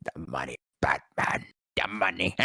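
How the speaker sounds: low, soft growled villain line; a light take on a greedy villain; Penguin impression. The speaker matching Penguin in the booth, low and soft. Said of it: "I got to do it right after".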